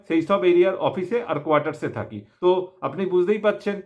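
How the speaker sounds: ordinary talk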